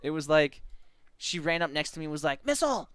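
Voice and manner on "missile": high-pitched dramatic hail; urgent call. Acted. Teammate running up next to player, urgent call.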